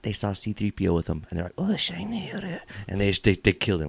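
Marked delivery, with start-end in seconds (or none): 1.6-2.3 high, put-on Japanese accent; mock Japanese accent. Comic voicing Jawas spotting C three P O, mock Japanese accent. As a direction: squeak it in a caricatured Japanese accent. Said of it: "I'm sorry to the Japanese accent".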